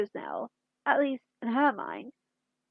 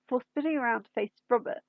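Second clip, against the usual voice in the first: usual speaking voice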